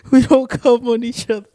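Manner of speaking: laughing through the words. Real, not acted. Host repeating his own slip, laughing. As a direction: giggle over the line he just flubbed.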